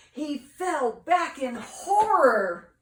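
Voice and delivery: storyteller at the scary moment; dramatic horror. A narrator's reading. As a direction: make the fall feel huge. Dramatic reading climax.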